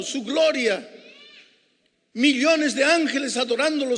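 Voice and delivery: high, loud sermon climax; preacher building to the climax, loud and high; full-force preaching. Acted. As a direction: drive it home to the congregation.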